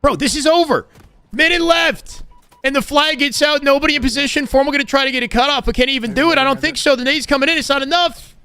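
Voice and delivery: high, loud esports caster call; excited play-by-play. Real, not acted. Caster calling the flag play as it falls apart, excited play-by-play. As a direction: call the final seconds at full pitch.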